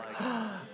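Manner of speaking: sharp happy gasp; excited gasp. Retold or imitated. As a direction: gasp with delighted surprise. Excited at pregnancy news.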